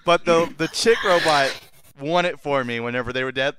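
ordinary talk

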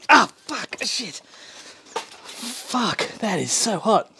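high strained cursing through pain. Real, not acted. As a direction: swear through a fresh burn. Just burned hand on blade.

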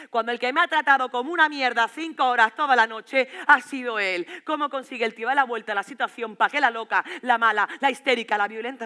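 loud, outraged public complaint; indignant tirade. Acted. Girlfriend protests to the audience about him, indignant tirade. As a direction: raise your voice in protest.

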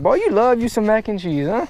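ordinary talk, nothing marked

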